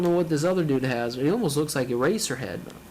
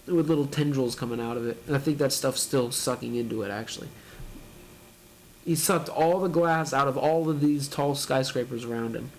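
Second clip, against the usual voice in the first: ordinary talk, nothing marked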